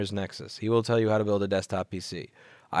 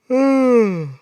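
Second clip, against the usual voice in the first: loud exasperated sigh; 14 semitones higher, 13 dB louder than usual. Real, not acted.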